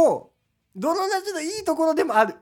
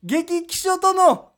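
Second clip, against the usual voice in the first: loud campaign speech voice; rally speech; 5 semitones higher, 5 dB louder than usual. Pretend. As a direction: orate like a candidate on a street corner. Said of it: "日本激気症党だ。".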